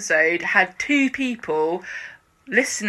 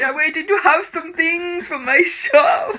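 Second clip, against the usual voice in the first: higher, slow, laughing through the words; laughing Dev voice; 8 semitones higher, slower than usual. Retold or imitated. Voicing Dev outside his shop, slow and laughing. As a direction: giggle through Dev's line.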